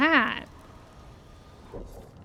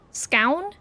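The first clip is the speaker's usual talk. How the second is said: usual speaking voice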